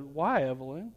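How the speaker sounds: a father's careful, hushed question; gentle concern. Retold or imitated. Dad asking his upset daughter why, soft gentle concern.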